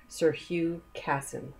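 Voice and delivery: plain reading voice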